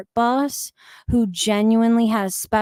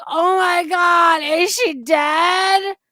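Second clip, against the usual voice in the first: loud, high, slow mock alarm; high drawn-out fake panic; mock panic shout; 8 semitones higher, 4 dB louder, slower than usual. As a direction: shout it like a gossip who thinks she's dead. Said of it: "they're like".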